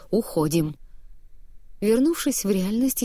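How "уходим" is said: terse order through the teeth; low-key, tight-jawed command; through clenched teeth. A narrator's reading. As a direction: force the word out tightly. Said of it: "процедил".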